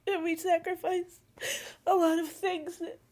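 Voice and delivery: tearful, voice breaking; fighting back tears; crying. Real, not acted. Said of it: "I'm sorry I'm crying".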